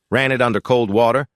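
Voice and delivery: ordinary delivery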